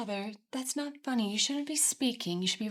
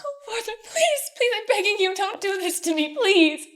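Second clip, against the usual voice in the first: high, loud pleading; desperate begging; 6 semitones higher, 6 dB louder than usual. Acted. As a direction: beg him with everything you have. Daughter begging father.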